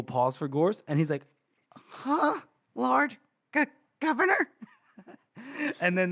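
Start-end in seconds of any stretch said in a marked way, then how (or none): 1.7-4.5 high, halting disbelief; stunned stammer. Acted. Gorst after a long uncomfortable pause, high stunned stammer. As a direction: squeak it out in pieces, dumbfounded.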